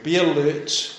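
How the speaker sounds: ordinary talk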